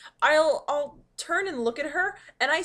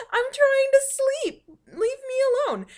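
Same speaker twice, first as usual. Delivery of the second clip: laughing complaint; 13 semitones higher, 10 dB louder than usual. Retold or imitated. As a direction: whine at the cat through laughter. Groggy retort to cat.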